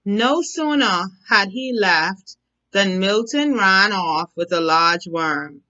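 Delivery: ordinary delivery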